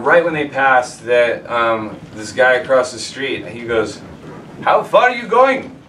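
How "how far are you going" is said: put-on high, loud Polish accent; old Polish man accent. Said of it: "I cannot do a Polish accent".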